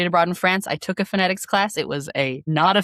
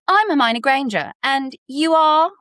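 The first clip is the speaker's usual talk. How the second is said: high British schoolgirl impression; pitched-up British accent; Hermione Granger; 8 semitones higher than usual. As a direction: put on a high, British film-character voice. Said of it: "then I started doing".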